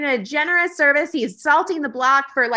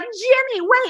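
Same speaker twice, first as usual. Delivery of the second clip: mock calling out; 11 semitones higher, slower than usual. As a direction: imitate a high-pitched "stop him" call. Retold calling after a thief.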